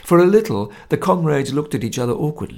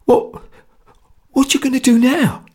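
forced question; 12 semitones higher, 5 dB louder than usual. A narrator's reading. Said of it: "he asked with an effort".

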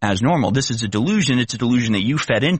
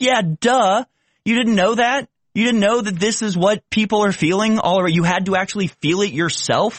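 pitched up, incredulous "duh"; high, scornful disbelief at people who only learn firsthand; exasperated disbelief; 8 semitones higher than usual. Real, not acted. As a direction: scoff at how obvious it is. Exasperated rant at hypocrites.

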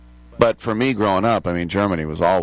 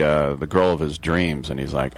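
usual speaking voice

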